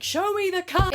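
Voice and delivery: loud, high mock-aggressive shout; aggressive demand. Brash customer storming the till, a hammed-up shout. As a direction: bark it like a tough guy. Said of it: "storm over really aggressively".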